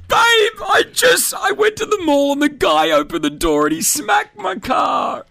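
high-pitched, blubbering mock wail; mock crying. Mimicking a boyfriend whining about his car, mock crying. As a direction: sob the complaint to your partner, exaggerated. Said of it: "crying like that all the time".

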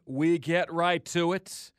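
ordinary delivery

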